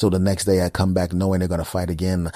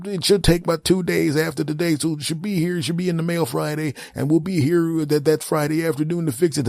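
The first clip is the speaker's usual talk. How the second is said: high, rambling excuse-making rep voice; high mock customer service voice; babbling company rep; 10 semitones higher than usual. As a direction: pile up nonsense excuses cheerfully.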